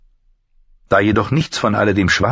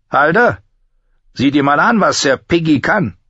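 high, sneering command; mocking master-at-arms; 9 semitones higher, 3 dB louder than usual. Master-at-arms sends Halder against the recruit, mocking. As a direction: call the fighter out with raised, scornful pitch.

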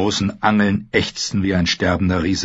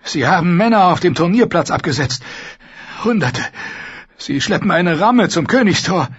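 urgent panting report; 8 semitones higher than usual. Acted. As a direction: report in a rush, out of breath. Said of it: "ein Bote keuchend". (keuchender Bericht)

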